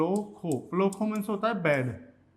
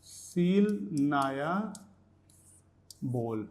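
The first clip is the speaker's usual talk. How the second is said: very slow, stretched syllables; dictation pace; 3 semitones lower, much slower than usual. Shown as a demonstration. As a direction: dictate it slowly while writing. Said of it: "तो यहाँ लिख देंगे हम".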